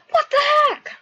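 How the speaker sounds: very high, loud shriek; hammed-up startled squawk; shocked shout. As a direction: yell it in disbelief. Acted shocked reaction.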